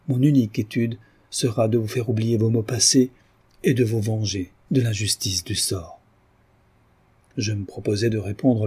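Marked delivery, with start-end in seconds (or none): none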